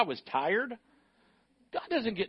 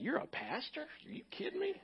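high, incredulous put-down, dropping softer; mock disbelief; 7 semitones higher, 11 dB quieter than usual. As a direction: imitate people scoffing at a grouchy pastor. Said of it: "people are like".

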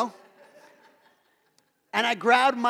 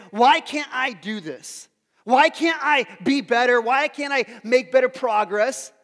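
frustrated lament; 3 semitones higher, 6 dB louder than usual. Retold or imitated.

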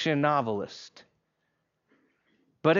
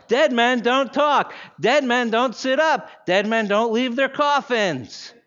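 loud, high, insistent declarations; raised-voice list, driving every phrase home; emphatic preaching; 6 semitones higher, 4 dB louder than usual. Real, not acted. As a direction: hammer out each line to the congregation. Preacher calling out the answer.

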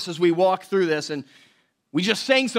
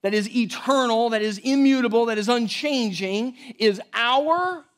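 louder and higher, building praise; rising preaching fervor; 5 semitones higher, 3 dB louder, slower than usual. Acted. Pastor exalting God's name to the congregation, fervently. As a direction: preach it with mounting force.

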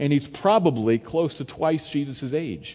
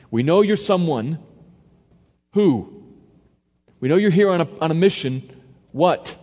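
pressing interrogators; 5 semitones higher, 5 dB louder than usual. Retold or imitated. Delegation probing Jesus' purpose.

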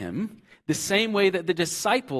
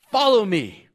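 ringing call; 7 semitones higher, 7 dB louder than usual. Acted. Jesus' call read out.

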